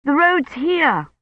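high, drawn-out call; boy's high call, stretched out. A narrator's reading. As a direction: call slowly across a distance. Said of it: "Colin called from somewhere beyond".

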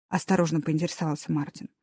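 plain reading voice

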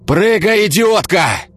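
high, loud desperate roar. A narrator's reading. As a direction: roar up at the window. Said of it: "взревел пожарный".

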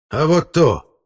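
high, loud challenge; defiant. Acted. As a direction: throw it back defiantly. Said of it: "с вызовом сказал дядя Лёня". (с вызовом, громко)

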